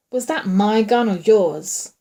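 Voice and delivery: slow, loud groan. A narrator's reading. Hurt man questioning his partner about the gun, groaned. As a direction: drag the question out in pain. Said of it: "the other voice groaned".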